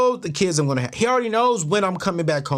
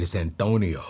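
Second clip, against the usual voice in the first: very low, softened one-word answer; deep mock-psychic reply; mimicking the psychic; 13 semitones lower, 4 dB quieter than usual. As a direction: copy her mysterious voice back at her. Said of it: "Because you're talking like that".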